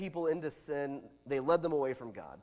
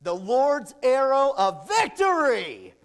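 prophetic declaration; 8 semitones higher, 10 dB louder than usual. Acted. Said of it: "Elisha declared".

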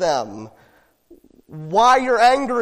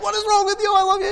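panicked parent yell; 11 semitones higher, much faster than usual. Retold or imitated. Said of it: "you go over and you're like".

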